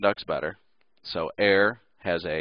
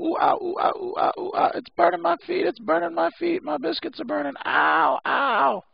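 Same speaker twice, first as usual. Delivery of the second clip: high yelping ouch on burning sand; hot sand yelps; 4 dB louder than usual. Retold or imitated. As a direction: hop and squeal, feet burning on sand. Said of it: "you go".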